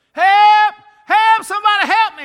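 screaming for help at the top of the lungs; frantic high screaming for help; panicked scream. Retold or imitated. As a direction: shriek high and loud like running for your life. Agent screaming in joke.

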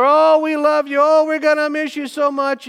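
high, loud, syrupy fake goodbye; mock gushing farewell. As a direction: gush like insincere well-wishers in the farewell line. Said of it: "lie after lie".

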